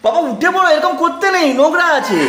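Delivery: high coaxing voice with a laugh. Real, not acted. Mother coaxing toddler off floor.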